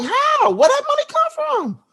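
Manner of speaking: high, loud disbelieving cry; astonished yelling. Retold or imitated. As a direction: shout in joyful disbelief. Amazed at his hidden savings.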